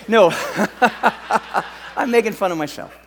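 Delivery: usual speaking voice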